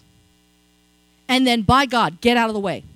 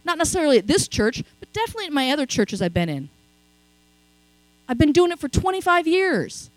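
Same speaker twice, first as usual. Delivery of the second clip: usual speaking voice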